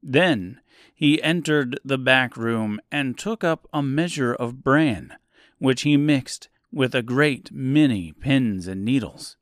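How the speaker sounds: ordinary delivery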